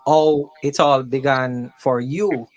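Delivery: usual speaking voice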